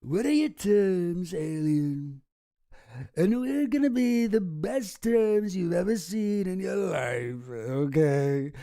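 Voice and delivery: Trump voice, drawn out and boastful; Trump impression. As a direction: do your best Trump. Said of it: "a Trump impression like me".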